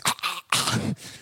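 voiceless strangled gasps; choking gasps. Acted. Believer yanked back by God's choke chain, choked gasps. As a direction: gag as if yanked back by the neck. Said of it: "You'd be walking around just".

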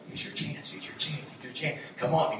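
soft whisper repeating "chance"; whispered urging. Retold or imitated. The Holy Ghost whispering to a hesitant teen, soft. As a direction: nudge them quietly in the ear. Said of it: "Holy Ghost in your ear going".

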